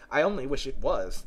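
usual speaking voice

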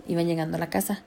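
normal talking voice

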